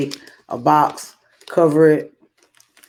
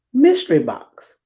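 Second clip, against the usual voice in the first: ordinary delivery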